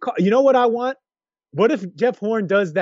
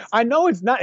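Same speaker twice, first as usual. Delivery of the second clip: usual speaking voice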